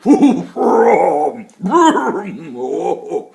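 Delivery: big hearty laugh, loud; booming tree laugh. Acted. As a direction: laugh like an ancient tree waking up. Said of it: "deep, woody-sounding words came out".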